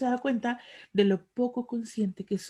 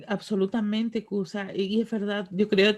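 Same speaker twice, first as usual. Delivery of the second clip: usual speaking voice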